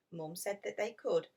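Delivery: ordinary delivery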